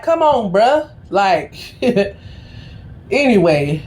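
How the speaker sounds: ordinary talk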